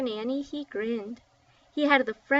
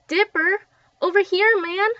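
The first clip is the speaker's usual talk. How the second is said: high, loud call across the storm; yelling; 8 semitones higher, 9 dB louder than usual. A narrator's reading. Little Mac's gang calling Dipper out of the storm, yelling. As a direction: shout him over through the rain. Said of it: "someone yelled".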